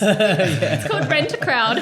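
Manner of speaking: laughing while joking. Real, not acted. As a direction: chuckle through the punchline. Joking about making friends abroad.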